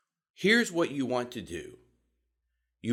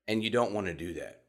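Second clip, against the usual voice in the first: ordinary delivery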